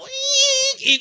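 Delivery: high squealing brake noise; tire screech. Retold or imitated. Preacher imitating the car skidding, high screech.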